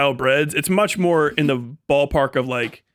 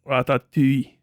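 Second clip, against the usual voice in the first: French accent. Shown as a demonstration. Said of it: "pronounce ratatouille with a French accent".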